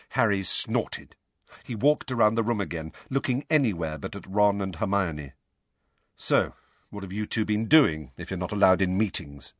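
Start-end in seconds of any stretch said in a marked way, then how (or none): none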